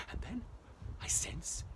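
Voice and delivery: ordinary delivery, nothing marked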